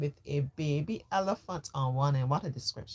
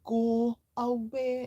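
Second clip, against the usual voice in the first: higher, louder shooing go away; go away again; 7 semitones higher, 4 dB louder than usual. Acted. Father shooing son from important call. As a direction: push him off firmly.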